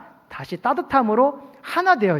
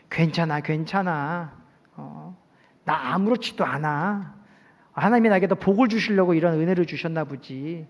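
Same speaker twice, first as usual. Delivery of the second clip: gentle forgiving tone; 3 semitones lower, 3 dB quieter than usual. Shown as a demonstration. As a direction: ease it out softly like letting it go. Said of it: "이 어투가". (부드럽고 느린 용서의 말투)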